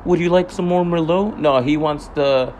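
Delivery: high, put-on polite server voice; mock waiter voice. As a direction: mimic the lady offering wine sweetly. Mocking the wine lady.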